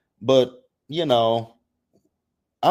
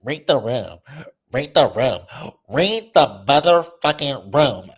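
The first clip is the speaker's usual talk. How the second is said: repeated, louder each time, ending slow and heavy; read the room, building; 3 semitones higher, 3 dB louder than usual. Real, not acted. Vlogger scolding a housewife, building to a slow yell. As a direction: hammer it home, building louder.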